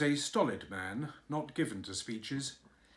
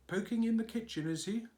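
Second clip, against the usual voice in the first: lightly voiced old nurse, higher; a hint of a brisk old nurse; 9 semitones higher, faster than usual. A narrator's reading. Old nurse quizzing the cook, lightly voiced.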